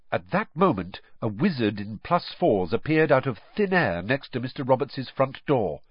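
plain reading voice

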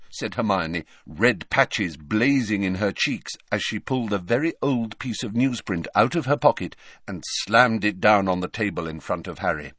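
ordinary delivery, nothing marked

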